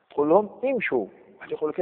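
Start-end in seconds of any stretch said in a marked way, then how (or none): 0.6-1.1 امشوا opening on "i": imshuu; kasra on hamza. Shown as a demonstration. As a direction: keep "i" first in امشوا despite its final "oo". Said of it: "نبقى على امشوا". (امشوا بكسر الهمزة)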